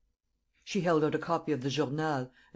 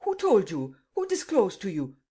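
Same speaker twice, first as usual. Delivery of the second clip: louder, higher spluttered questions; spluttering; 6 semitones higher, 5 dB louder than usual. A narrator's reading. Steinweg demanding who told, spluttering. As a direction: blurt it out in shock. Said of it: "He spluttered out again".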